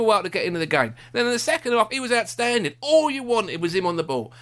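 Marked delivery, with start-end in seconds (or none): none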